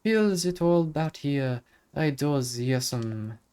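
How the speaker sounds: lilting Irish brogue, a touch Scottish; sing-song Irish fairy voice; Irish accent. Acted. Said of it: "I feel like I'm more Scottish".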